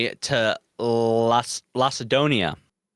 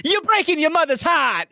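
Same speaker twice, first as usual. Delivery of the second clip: much higher, hammed-up cartoon dad voice; mock Peter Griffin; 4 dB louder than usual. Retelling a Family Guy skit, much higher hammed-up dad voice. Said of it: "And Peter goes".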